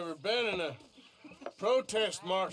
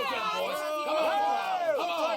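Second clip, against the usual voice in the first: jeering; 7 semitones higher, 3 dB louder, much slower than usual. Acted. Hecklers jeer at the march.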